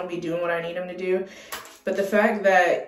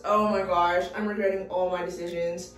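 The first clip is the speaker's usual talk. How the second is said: ordinary talk, nothing marked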